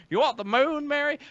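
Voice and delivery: put-on high, old-movie hero voice; George Bailey voice. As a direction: play the classic film hero. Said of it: "George Bailey".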